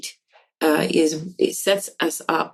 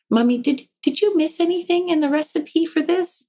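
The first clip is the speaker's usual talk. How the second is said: a lightly voiced gentle four-year-old, higher; a four-year-old gently asking his mother about the recipe, tender; tender little boy; 10 semitones higher than usual. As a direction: ask it gingerly, like a small child. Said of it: "very gingerly and very tenderly and says".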